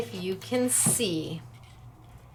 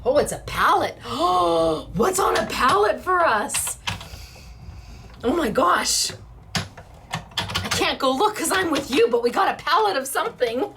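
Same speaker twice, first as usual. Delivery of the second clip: higher and brighter, thrilled about the surprise; excited; 5 semitones higher than usual. Real, not acted. Crafter telling viewers a pallet arrived, excited.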